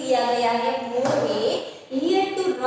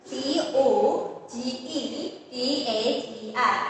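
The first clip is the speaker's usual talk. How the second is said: slow spelling; slower than usual. Shown as a demonstration. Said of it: "next one is".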